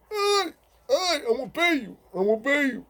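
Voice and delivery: high crying deaf-voice plea; imitated begging cry. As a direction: beg high and teary. Deaf friend begging while beaten.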